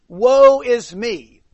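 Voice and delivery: slow, loud, drawn-out cry of woe; exclamation of distress. Retold or imitated. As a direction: stretch it out like a groan of despair. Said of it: "He breaks out with an exclamation of distress".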